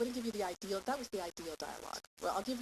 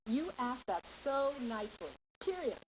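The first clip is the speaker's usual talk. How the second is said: slow, "period" said high and final; slow praise ending on a high, firm "period"; full stop after praise; 5 semitones higher, 3 dB louder, slower than usual. Shown as a demonstration. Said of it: "full period after the end".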